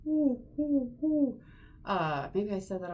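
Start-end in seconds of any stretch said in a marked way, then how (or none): 0.1-1.4 high hooting owl oohs. Shown as a demonstration. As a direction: hoot like an owl. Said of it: "find the owl hoot".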